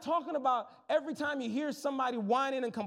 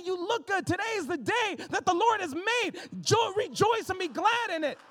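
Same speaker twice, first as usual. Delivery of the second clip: higher, louder, beaming greeter; excited welcome; 7 semitones higher, 3 dB louder than usual. Pretend. Greeter welcoming a newcomer in the parking lot, excited and beaming. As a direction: throw the door open with joy.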